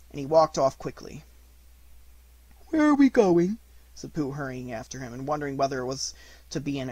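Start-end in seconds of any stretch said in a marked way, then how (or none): 2.7-3.6 much higher, louder bear voice; little bear voice. Acted. Pooh hurrying after Christopher Robin, high and eager. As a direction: sound small and eager as Pooh.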